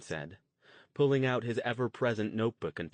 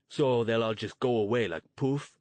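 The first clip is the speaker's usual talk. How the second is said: louder, scornful challenge; demanding; 2 semitones higher, 7 dB louder than usual. Acted. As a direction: press him, mocking the idea. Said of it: "Luke demanded".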